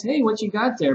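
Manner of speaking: casual, interested teacher; showing interest. Shown as a demonstration. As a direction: sound intrigued by what the student has. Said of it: "And then you show interest".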